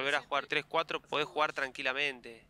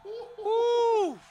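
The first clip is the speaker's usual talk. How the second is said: high, loud cry of awe; amazed gasp; 5 dB louder than usual. Real, not acted. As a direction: let it burst out in wonder. Reacting to a great goal.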